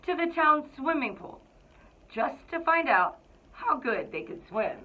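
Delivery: lively, expressive picture-book narration; storybook read-aloud. A narrator's reading. Principal reading to the Cougars, storybook narration. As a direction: read it like you're sharing a favorite book with kids.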